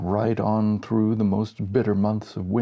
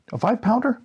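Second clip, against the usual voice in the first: amazed question; 12 semitones higher, faster than usual. Acted. Incredulous reply.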